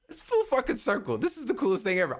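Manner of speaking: thrilled. Retold or imitated. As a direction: gush like a fan whose dream came true. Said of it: "And I was like".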